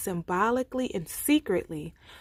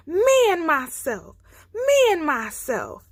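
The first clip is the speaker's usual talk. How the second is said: loud, high roaring chant; roaring his head off; 8 semitones higher, 7 dB louder than usual. A narrator's reading. He lion facing Man's gun, roaring his head off. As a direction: roar with all his might. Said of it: "roaring his head off".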